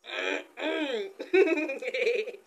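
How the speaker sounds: loud burst of laughter; long amused laugh. Real, not acted. Laughing amid mock complaint about baby.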